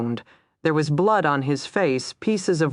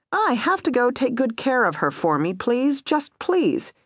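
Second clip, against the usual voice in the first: pleading; 10 semitones higher than usual. A narrator's reading. J T begging for sister's care.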